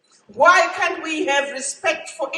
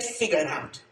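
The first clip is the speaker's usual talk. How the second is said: much lower, softer echo of "Figure it out"; quiet repeat; 12 semitones lower, 10 dB quieter than usual. Retold or imitated. Madam Zuma's advice repeated low and soft to herself, quiet. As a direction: drop "Figure it out" low and quiet, like a private reminder. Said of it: "She said".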